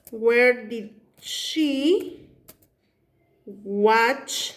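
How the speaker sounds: each word drawn out very slowly, What time did she; slow dictation. Shown as a demonstration. Teacher dictating to students while writing, very slow. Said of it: "pude haber puesto así".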